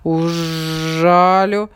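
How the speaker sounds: slow, loud buzzing threat; buzzing bee voice. A narrator's reading. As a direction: buzz it like a chasing bee. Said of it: "жужжит".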